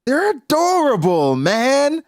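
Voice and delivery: high, loud, gushing adorable; Garling's fake delight. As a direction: coo over the twins in a high voice. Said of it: "Garling says".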